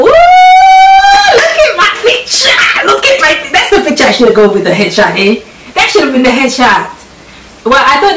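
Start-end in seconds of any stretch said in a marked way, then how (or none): 0.0-4.0 real joy, almost shouting; delighted shriek. Real, not acted. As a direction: squeal it at the screen. Sees her photo in article.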